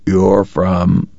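a raw-throated croak; sick young man croaks to the kind lady. Acted. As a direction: force it past a sore throat. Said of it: "and croak".

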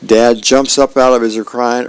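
usual speaking voice

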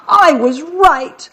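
slow, high-pitched acted wail; tearful sob. A narrator's reading. Kathy insists to Damien she was right, sobbing. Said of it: "she sobbed".

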